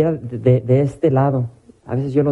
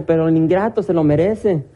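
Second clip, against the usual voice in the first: higher, hammed-up aggrieved wife; mock-indignant justification; 4 semitones higher than usual. Wife justifying cursing her husband, mock indignation. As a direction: play her self-righteous about her husband, pitch raised.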